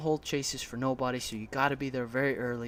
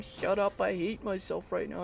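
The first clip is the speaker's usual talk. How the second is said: higher, held-in mock exasperation; retold inner frustration; 5 semitones higher than usual. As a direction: grumble to yourself in a raised pitch. Acts out his inner frustration.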